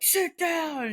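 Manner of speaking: lightly voiced stern pack-leader command. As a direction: order the dog like the pack leader. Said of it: "pack leader".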